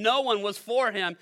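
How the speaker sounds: ordinary talk, nothing marked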